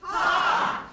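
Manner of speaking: high, laughing mock yells of "Ha"; enthusiastic shout. Shown as a demonstration. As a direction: cry "Ha" high and playful, half laughing. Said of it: "do it with commitment, enthusiasm".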